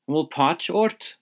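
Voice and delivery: "And will páirt ort?" with a high, rising question tune; asked as a question. Shown as a demonstration. Teacher models asking Padraig about his hangover, rising. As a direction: ask "páirt ort" with the voice lifting up. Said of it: "So ask that again".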